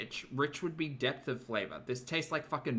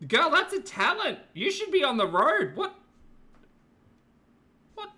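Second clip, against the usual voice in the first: amazed squealing; 10 semitones higher, 6 dB louder than usual. Real, not acted. Amazed by friend's burps.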